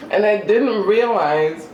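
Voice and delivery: ordinary talk, nothing marked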